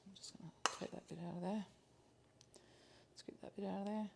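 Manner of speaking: fast whispered aside to herself; narrating under her breath as she scoops. Real, not acted. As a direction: whisper it while scooping, quickly. Quiet aside while scooping.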